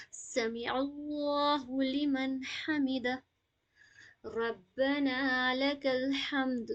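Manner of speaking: very slow, with a full ha sound in الحمد; slow recitation demo. Shown as a demonstration. Teacher models the ha sound for students. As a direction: recite slowly, bring out the ha. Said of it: "ہا کی sound کو proper نکالیں گے".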